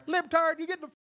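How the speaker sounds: high, loud mocking yell; hammed-up shouted caricature. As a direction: ham up an angry local shouting. Mocking aggressive neighbors.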